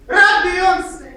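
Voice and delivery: a loud hawker's call; projected voice. Shown as a demonstration. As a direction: project it like a street hawker calling customers. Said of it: "learned how to project my voice".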